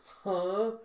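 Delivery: scornful huh. Acted. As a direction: throw it back at them, loud and high. Girl scoffs at newcomer's rudeness.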